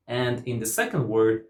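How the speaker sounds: ordinary talk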